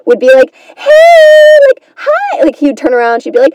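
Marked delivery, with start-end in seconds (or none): 0.8-1.8 put-on very high, loud girly squeal; hammed-up high cutesy girl; naive sweet girl. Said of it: "just totally naive". 2.0-2.4 tiny, very high cutesy greeting; squeaky sweet girl voice; naive sweet girl. Acted. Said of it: "just totally naive".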